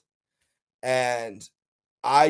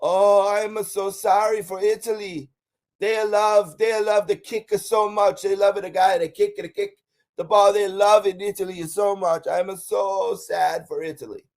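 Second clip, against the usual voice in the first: great-grandma crying over Italy's loss, imitated in a higher voice; imitating old grandma; 6 semitones higher than usual. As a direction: lift the pitch and lament like a heartbroken old grandma. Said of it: "And she said".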